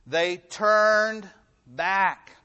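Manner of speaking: drawn out, each word weighed; very slow, loud preacher emphasis; slow emphatic. Real, not acted. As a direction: stretch it out, it's the key point. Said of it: "That's the key here".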